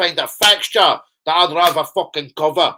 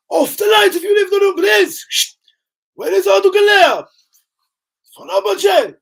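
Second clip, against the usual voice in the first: much higher, loud put-on Scottish accent shout; hammed-up Scottish accent; 7 dB louder than usual. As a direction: holler it in a mock Scottish accent. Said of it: "hear better the Scottish accent".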